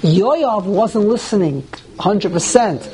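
ordinary talk, nothing marked

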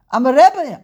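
puffed-up Rebbe bragging; proud boast. Acted. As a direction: crow it proudly.

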